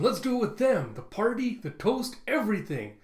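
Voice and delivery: high, excited acted voice; loud, high party-rallying call; Luffy rallying everyone. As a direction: cheer it out, pitched well up. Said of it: "you see Luffy going".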